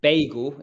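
BAGEL said as BAY-gul; American pronunciation. Shown as a demonstration. Explaining the American way, BAY-gul. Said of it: "a very American way to say it".

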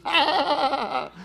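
a high, startled "Ah!" as the penny drops; sudden realization. Retold or imitated. Husband catching himself in front of his wife, startled. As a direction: caught out, gasp it.

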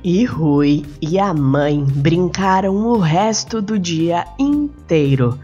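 usual speaking voice

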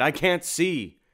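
high, loud frantic wail; a blinded monster crying out; high loud panic; panicked cry. Acted. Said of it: "it cried".

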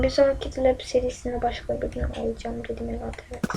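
ordinary talk

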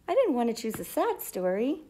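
ordinary delivery, nothing marked

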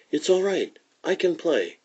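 high, bright-toned announcement of relief; eager, high reassurance; relieved excitement. Acted. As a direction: pitch jumps way up with relief. Schroeder returns relieved after testing finger.

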